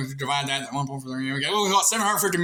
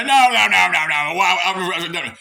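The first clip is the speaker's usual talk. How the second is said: mock protest; 2 semitones higher, 6 dB louder than usual. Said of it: "Some of you say".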